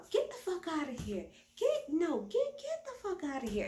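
mock drunk yell. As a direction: shoo the kid away like a drunk auntie. Drunk Erica cursing at Jax.

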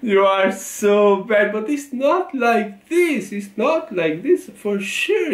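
high, swooping protest after a laugh; amused protest. Real, not acted. Scolding a joker, high amused protest. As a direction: plead in a high, drawn-out voice.

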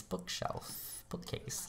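trying the words out in a hush; a private whisper, searching for the right word; whispered to self. Real, not acted. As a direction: whisper the two words under her breath. Muttering to herself, picking a word.

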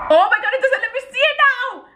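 high, rushed outburst; frustrated surprise. Real, not acted. Checking her phone for his message, frustrated surprise. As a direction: blurt it out in a high, fast voice.

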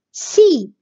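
سي as one short, separate syllable; short kasra syllable. Shown as a demonstration. Teacher shows seen with kasra, short syllable. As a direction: give سي briefly, not held. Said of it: "بيجيني كسرة".

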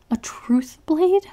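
slow, whispered, rising disbelief; shocked whisper. A narrator's reading. Prisoner shocked by the truth blade, whispered. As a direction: breathe it out in disbelief.

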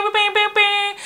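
high, loud buzzer ringing; imitated buzzer. Mimicking the apartment buzzer that always rang, high imitated ring. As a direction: chant it high like an insistent buzzer. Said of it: "my buzzer would always ring".

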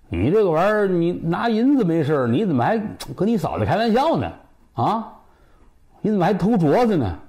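indignant scolding. Acted. The father scolds his son for stealing the bracelet, indignant scolding.

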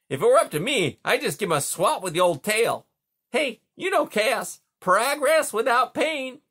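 a sleepy beaver calling across the pond. Acted. Beaver dialogue.

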